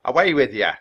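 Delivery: angrily ordering someone off; angry shout. A narrator's reading. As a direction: shout it like an irate owner. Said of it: "someone shouted angrily".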